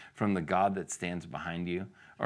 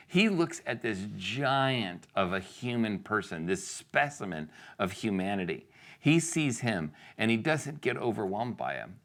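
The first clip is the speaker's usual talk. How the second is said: normal talking voice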